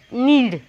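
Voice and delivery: "knead" pronounced exactly like "need"; silent k in "knead". Shown as a demonstration. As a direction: start "knead" cleanly on the n. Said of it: "what you have to pronounce it".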